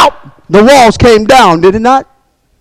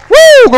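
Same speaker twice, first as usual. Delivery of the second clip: high-pitched whoop of praise; exultant whoop. Real, not acted. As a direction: let out a joyful preacher's woo. Exultant whoop mid-sermon.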